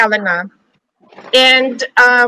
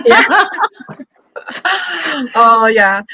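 laughing; slower than usual. Real, not acted. Laughing at her own struggle.

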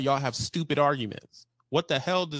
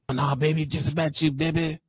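mushy, slurred mock cooing; mock lying sweet-talker. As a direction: lightly mimic a man sweet-talking women. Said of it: "You lying like a motherfucker".

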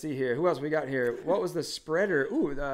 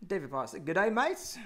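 hammed-up Australian accent, pitched up; a playful Aussie greeting in an Australian accent; mock Australian accent; 4 semitones higher than usual. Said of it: "That's what it sounded like".

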